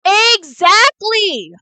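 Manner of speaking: loud, high, drawn-out exclamation; emphatic agreement. Real, not acted. Player agreeing with the game, emphatic shout. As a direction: stretch it out big and loud.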